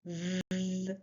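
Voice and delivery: sustained V for learners to copy; voiced th demo. Shown as a demonstration. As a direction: hold V steady with the voice on. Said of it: "Say it with me".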